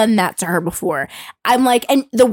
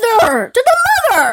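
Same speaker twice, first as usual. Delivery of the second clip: much higher, louder indignant squeal with a laugh; outraged emphasis; 10 semitones higher, 4 dB louder than usual. Acted. Outraged mother, high laughing emphasis.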